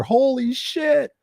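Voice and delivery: drawn-out high holy shit; imitated startled cry. Wife crying out at the drop, slow and high. Wife yelling mid zip line.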